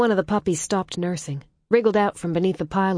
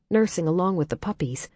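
ordinary delivery, nothing marked

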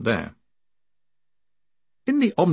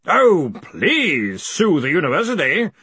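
slow, raised, mocking bellow; 7 semitones higher, 5 dB louder, slower than usual. A narrator's reading. Ridcully dares them to sue, drawn-out bellow. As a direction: bellow it out, stretching the invitation. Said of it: "Ridcully bellowed".

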